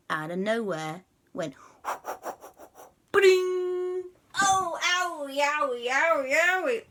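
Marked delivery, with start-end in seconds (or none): 3.1-4.1 very high ringing ba-ding; cartoon bonk. Fishing line lands on Quasi, high cartoon bonk. Object drops on his head. 4.4-6.8 very high, whiny owies; mock pain. Quasi hit on the head, high mock pain. Hurt by falling object.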